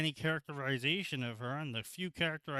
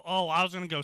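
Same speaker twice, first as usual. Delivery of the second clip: ordinary talk, nothing marked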